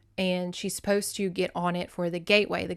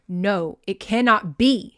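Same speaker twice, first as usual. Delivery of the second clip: loud, emphatic refusal; spat out with loathing; 8 dB louder, slower than usual. Acted. Tony refusing to believe it, spat with loathing. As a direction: spit out each word forcefully. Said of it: "he spat".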